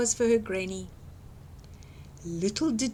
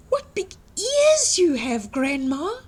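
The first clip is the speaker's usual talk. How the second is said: put-on higher, louder amazed girl; wondering girl; 7 semitones higher, 6 dB louder than usual. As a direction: marvel at the big ears. Girl questions the wolf.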